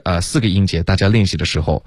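ordinary talk

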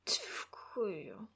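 whispered, slurred mumble, very soft; barely voiced, fading whisper of a drugged man; faint mumble. A narrator's reading. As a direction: mumble it with your last bit of strength. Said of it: "пробурмотів він з останніх сил".

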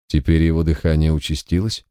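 ordinary delivery, nothing marked

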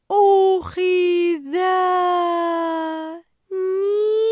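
very slow, loud, stretched whale call; whale speak. Acted. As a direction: drag every sound out like a whale. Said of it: "وهي تتحدث لغة الحيتان". (لغة الحيتان بصوت ممدود بطيء جدًا)

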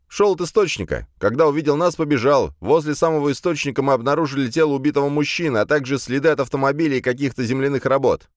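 high-pitched official report; eager escort reporting in; brisk report. A narrator's reading. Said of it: "бодро отрапортовал мой конвоир".

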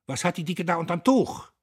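loud, high barked question; shouting. A narrator's reading. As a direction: boss yelling suspiciously at his foreman. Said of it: "schrie er plötzlich". (laut schreiend)

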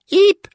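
very high, loud little squeal; startled squeak. A narrator's reading. Child dodging father's snipping fingers, high squeal.

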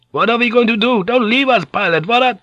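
high-pitched, frantic pleading; panicked cry. Acted. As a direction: beg the pilot not to leave. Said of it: "Van Neck cried out".

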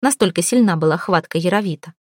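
plain reading voice

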